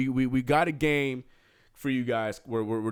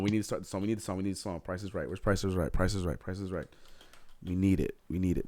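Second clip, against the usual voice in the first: ordinary delivery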